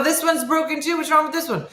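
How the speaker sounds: high-pitched, indignant comeback; fed-up and incredulous; exasperated protest. Retold or imitated. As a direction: argue back, pitch jumping up. Acted bike argument.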